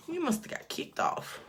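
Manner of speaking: high unvoiced whispered repeat. Real, not acted. Whispering to mom who got kicked off the stream.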